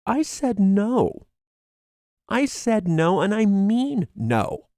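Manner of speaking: defiant no to a pushy authority; firm angry no. Pretend. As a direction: plant your feet and refuse. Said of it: "get the courage to say".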